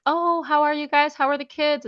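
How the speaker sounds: mom's light cheery hello; sweet mom voice. Retold or imitated. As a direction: play the chirpy mom on the phone. Mother voiced on sweet call.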